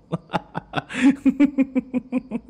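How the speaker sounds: a long, high, amused laugh; delighted laugh. Acted. Vampire amused by the hunter's defiance, long laugh.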